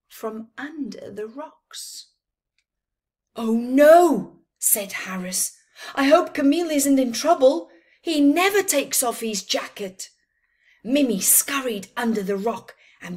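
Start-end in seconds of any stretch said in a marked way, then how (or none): 3.4-4.4 loud, high alarmed cry; worried storybook cry. Acted. Character alarmed at jacket. 5.9-10.1 fast, loud and high worried voice; fretful friend voice. Acted.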